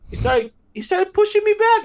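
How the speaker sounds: high and loud; excited rush. Real, not acted. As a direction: blurt it out all worked up. Excited retelling of shove.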